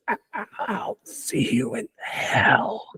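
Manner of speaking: breathless, gasping dying whisper. Acted. Dying fighter whispering a final curse. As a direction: rasp out the last words with failing breath. Dying villain's last words.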